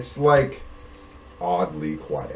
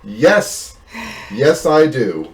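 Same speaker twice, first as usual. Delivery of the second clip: loud, raised, slowing to emphasis; irritated mercenary answering; 7 semitones higher, 8 dB louder, much slower than usual. Acted. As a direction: answer the shouter back forcefully, drawn out. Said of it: "He turns around and says".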